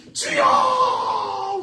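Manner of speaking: long drawn-out, high, loud shout. Acted. Blind men calling Jesus over the crowd, drawn-out shouted plea. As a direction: cry out over the crowd, stretching the word. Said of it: "더 큰 소리로".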